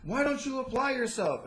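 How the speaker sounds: mock teacher nagging. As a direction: imitate a preachy teacher lecturing a kid. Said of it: "I would always get the same thing".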